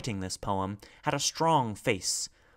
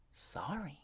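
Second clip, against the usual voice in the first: very soft, apologetic whisper; 6 semitones higher, 11 dB quieter than usual. A narrator's reading. B apologises to the messenger for speaking up, whispered. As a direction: apologise under your breath. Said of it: "she whispered".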